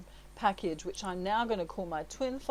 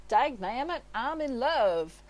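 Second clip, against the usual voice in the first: higher, louder hammed-up cowboy; a cowboy blurting out he's smitten; cowboy from a horse commercial, loud and surprised; lovestruck cowboy; 4 semitones higher, 4 dB louder than usual. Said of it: "there's a guy riding a horse".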